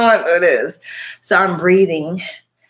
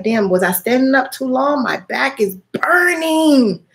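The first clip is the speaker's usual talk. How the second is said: alarmed complaint; 6 semitones higher than usual. Retold or imitated. Retold burning back alarm.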